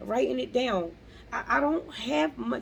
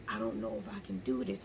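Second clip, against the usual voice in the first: imitating timid doubt, low, soft and quick; small, hurried mock-timid murmur; timid self-doubt; 6 dB quieter, faster than usual. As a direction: voice your old scared self under your breath. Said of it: "scared life, that timid life".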